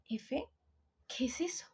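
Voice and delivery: hushed, puzzled mom asking what it means; baffled mom whisper. Retold or imitated. Mom hears teen slang, asks in a baffled whisper. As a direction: whisper it like a confused parent.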